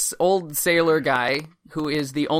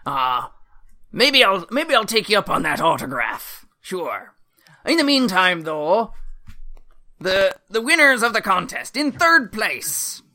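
put-on old sailor voice; 4 semitones higher than usual.

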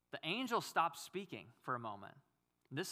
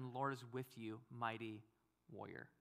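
soft, low, intimate reading; hushed reading; 4 semitones lower, 7 dB quieter than usual. Shown as a demonstration. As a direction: lower and much softer, nearly breathed. Angel's greeting repeated, hushed.

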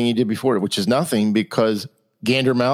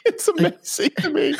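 laughing while talking; high laughing disbelief; 3 dB quieter than usual. Real, not acted.

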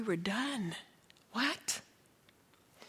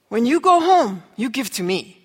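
put-on Haitian accent; 2 semitones higher, 9 dB louder than usual. Haitian teen asking for the shoes, lightly imitated Haitian accent. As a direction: imitate the teen's Haitian accent, pushing the request.

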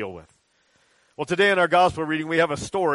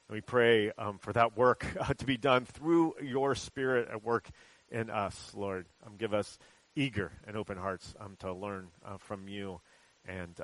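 hushed, lower prayer voice; softer prayer; 4 semitones lower, 6 dB quieter than usual. Acted. Pastor leads the congregation in a hushed prayer. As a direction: pray quietly and steadily before the people.

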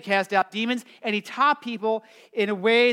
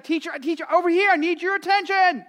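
put-on pestering child; 11 semitones higher, 6 dB louder than usual. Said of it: "Here's a guy like".

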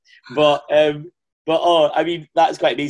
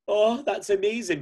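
higher and slow, hammed-up delight; mock gushing; 10 semitones higher than usual. Host recalling his thoughts about Kylie, mock gushing. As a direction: play up your own starstruck reaction. Retelling own gushing reaction.

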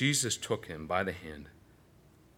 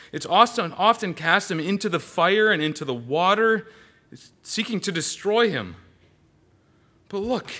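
raised, louder desperate father begging; pleading father; 9 semitones higher, 6 dB louder than usual. Acted. Father begging Jesus to heal his son, pleading loud. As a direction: beg like a father whose son is dying.

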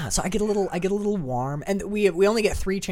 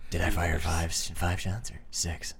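much lower, softer tough-cop voice; Dirty Harry quote; 12 semitones lower, 7 dB quieter than usual. Retold or imitated. Quoting Dirty Harry to his cohost, low tough-guy voice. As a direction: deliver it like a cold movie cop.

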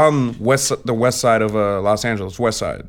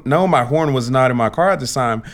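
usual speaking voice

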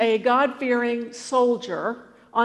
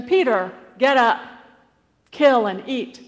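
commanding heavenly voice; 2 semitones higher, 6 dB louder than usual. Acted.

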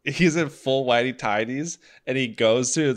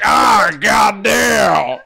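slow high yell; shout; 8 semitones higher, 10 dB louder, much slower than usual. Retold or imitated. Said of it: "It's like".

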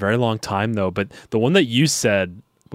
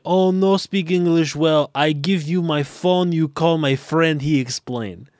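higher, slow broken English in a put-on foreign accent; fake foreign accent; 6 semitones higher than usual. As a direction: pretend to a security officer you can't speak English, in a put-on foreign accent. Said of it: "put on the same accent".

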